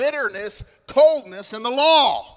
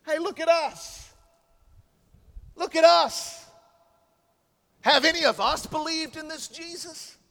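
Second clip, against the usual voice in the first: higher, sneering religious elite; haughty Pharisee; 8 semitones higher than usual. Acted. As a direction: talk down to the officers. Pharisees boasting, paraphrased.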